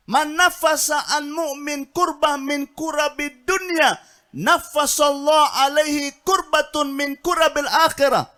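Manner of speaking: tender comfort turned into a booming call from the pulpit; fiery preaching. Acted. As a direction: preach it out at full force to the crowd. Preacher declaims the Prophet's words.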